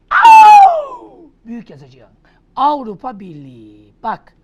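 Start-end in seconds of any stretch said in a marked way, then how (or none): 0.1-1.3 very high, loud cry; mock howl. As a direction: let out a comic howl. (yüksek sesli komik uluma)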